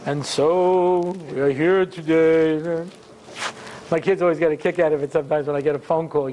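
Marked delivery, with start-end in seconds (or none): 0.1-2.9 slow, stretched-out rabbinic pomposity; drawn-out speechifying rabbi; sounding like a Rav. Acted. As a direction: intone it very slowly, like a rabbi opening a speech. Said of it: "they sound like a Rav".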